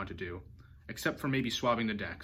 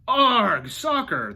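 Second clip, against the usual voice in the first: very loud, much higher echo; crew yelling; 9 semitones higher, 10 dB louder, much slower than usual. A narrator's reading. Crew echoes Braidbeard on deck, loud yell. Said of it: "the crew yelled".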